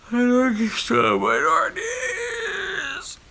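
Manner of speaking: excited cry. Real, not acted. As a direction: fling the title out with a thrill. Announcing the next big book.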